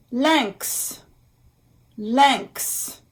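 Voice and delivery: "Lynx" in French, nasal vowel, then "ks"; careful French pronunciation. Shown as a demonstration. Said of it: "Y N".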